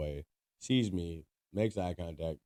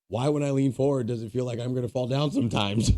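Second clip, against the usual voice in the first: laughing while quoting; 6 semitones higher, 7 dB louder than usual. Retold or imitated. Retelling the man's strange complaint, laughing through it. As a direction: quote broken up by laughter. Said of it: "he said".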